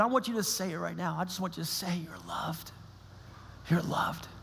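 hushed, half-whispered comfort; tender reassurance. Retold or imitated. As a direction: softly reassure a hurting child, imitating a dad. Dad tenderly reassures his daughter.

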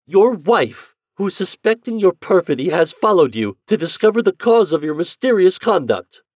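high, loud accusing tone; wife confronting her husband; indignant accusation. Acted. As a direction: confront him with his deceit.

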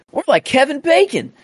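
high-pitched hint of a Southern accent; Gamora, lightly Southern. Gamora at the end of the fight, high, lightly Southern. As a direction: imitate the line high, faintly Southern. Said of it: "it just sounded Southern for some reason".

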